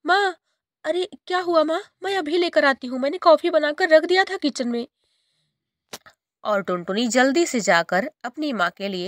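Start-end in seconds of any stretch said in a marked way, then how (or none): 0.1-4.9 put-on high, hurried child's reply; a meek girl rushing to please; little girl voice. Girl answers her shouting stepmother, high and hurried.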